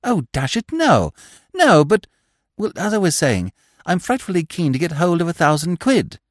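put-on an anxious young toff pleading for help; Freddy's voice.

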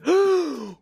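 shocked gasp. Retold or imitated. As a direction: gasp at your own blunder. Customer realizes his blunder.

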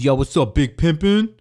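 loud, high mock hype greeting; cocky customer. Scrawny customer greeting the store buyer, cocky and loud. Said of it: "he goes".

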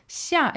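xia with a falling fourth tone. Shown as a demonstration. Teacher spelling out xia, falling fourth tone. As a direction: let the pitch of xia drop. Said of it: "X I A fourth tone".

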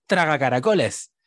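loud mock yell of a spell; retold shout. Ron defending Hermione with his bad wand, retold shout. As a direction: shout it like Ron casting a curse. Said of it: "y le grita".

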